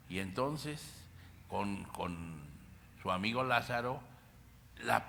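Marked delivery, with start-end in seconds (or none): none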